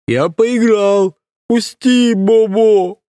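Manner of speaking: put-on very high, slow wailing man-child; childish whine. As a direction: squeal like a big baby in pain. Idiot whines, ear pulled.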